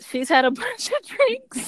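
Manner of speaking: high-pitched, breaking into laughter; laughing. Real, not acted. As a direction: say it through a real laugh. Laughs while retelling friend's drinking.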